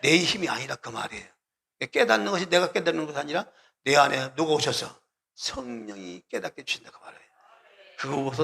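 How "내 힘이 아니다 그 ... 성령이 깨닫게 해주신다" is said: ordinary talk, nothing marked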